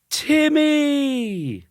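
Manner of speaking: high, loud sing-song call of a name; group shout. A narrator's reading. Family greeting Timmy in a high loud call. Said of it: "everyone shouted".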